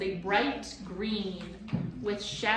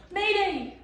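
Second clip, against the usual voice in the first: high loud panicked squawk; panicked scream; 12 semitones higher, 8 dB louder than usual. A narrator's reading. Bird panicking as creatures swarm, screaming. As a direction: shriek it in a panic. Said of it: "panicked and screamed her traditional".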